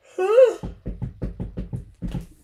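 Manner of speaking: a big breathy sigh at the end of a yawn; loud yawning sigh. Real, not acted. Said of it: "I'm all yawning".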